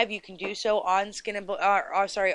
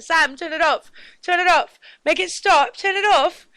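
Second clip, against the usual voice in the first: much higher and louder mock pleading; annoyed friend begging; 8 semitones higher, 6 dB louder than usual. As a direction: beg him to make it stop, hammed up.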